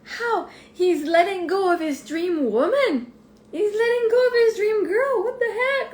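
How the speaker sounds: near-tearful incredulous outburst; past self disbelief. Retold or imitated. Vlogger imitating her younger self, high and distraught. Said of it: "I was like".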